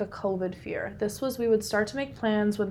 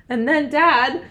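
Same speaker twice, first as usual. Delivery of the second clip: ordinary delivery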